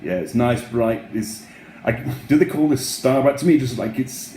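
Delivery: ordinary delivery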